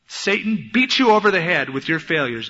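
ordinary delivery

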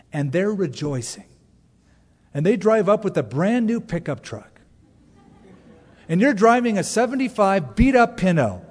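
ordinary talk

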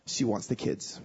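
low, hushed, final; grave bad news. Best friend telling him his marriage is over, grave and low. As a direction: deliver it low with heavy pauses.

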